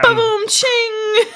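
slow drawn-out drum sting; vocal rimshot, high and loud; rimshot sound effect. Pretend. Rimshot after a joke.

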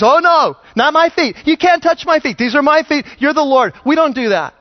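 high, loud, flustered protest; protesting Peter. Retold or imitated. Peter protesting, voiced loudly.

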